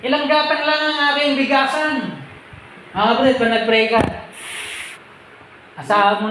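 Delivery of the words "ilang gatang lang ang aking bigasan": high, pleading voice of a poor believer; a humble, whining petition to God; pleading prayer voice. Retold or imitated. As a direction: plead to the Lord about having so little. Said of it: "Pag sinabi mo".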